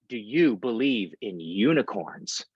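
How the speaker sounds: slow, deliberate re-asking; slow emphatic repeat. Retold or imitated. Young driver repeating his question to the cashier, slow and deliberate. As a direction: spell it out slowly, as if to someone who missed it.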